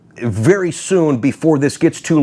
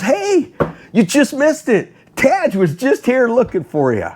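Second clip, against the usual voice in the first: high, teasing crowd imitation, laugh creeping in; excited calling out; 10 semitones higher than usual. Booth crowd telling him he just missed Taj, high excited calling. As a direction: imitate friends hailing someone with news.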